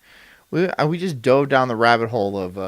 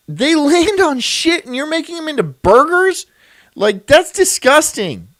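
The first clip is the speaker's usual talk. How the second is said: disgusted; 9 semitones higher, 5 dB louder than usual. Real, not acted. Disgusted rant about eating flies.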